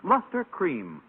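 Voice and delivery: ordinary delivery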